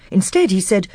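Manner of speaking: usual speaking voice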